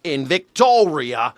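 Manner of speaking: mimicking an Australian's broad vowels; mock Australian accent. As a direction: parody the Aussie guest's accent. Mimicking the Australian guest just played.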